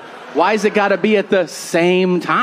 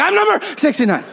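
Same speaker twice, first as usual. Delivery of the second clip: much higher, quick, gleeful boast; an excited kid bragging about his jersey; proud kid; 11 semitones higher, faster than usual. Retold or imitated. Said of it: "I was like".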